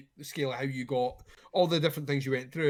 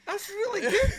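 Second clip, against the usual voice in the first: very high, put-on granny voice. Friend doing a silly bit for laughs, high gran voice. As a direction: squeak it out like a Scottish grandma. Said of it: "Sounds like my gran a wee bit".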